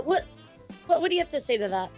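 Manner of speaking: ordinary talk, nothing marked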